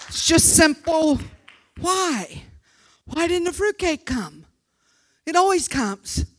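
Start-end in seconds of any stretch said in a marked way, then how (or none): none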